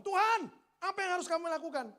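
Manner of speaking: imitating a loud, high cry upward; shouted plea to God. Joshua crying to the Lord before Jericho's walls, shouted plea. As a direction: call out to God at full voice. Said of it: "Teriak sama Tuhan".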